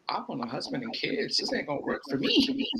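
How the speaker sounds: high, soft, plaintive retold worry; squeaky little mock complaint; mock whiny protest. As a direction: protest lightly in a small high voice. Retelling her doubts about wrestling life.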